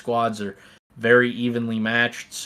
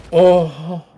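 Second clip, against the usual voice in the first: higher, louder, breaking into a yell; excited shout; 6 semitones higher, 9 dB louder than usual. Real, not acted. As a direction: let the oh burst out. Caster erupts at wild play.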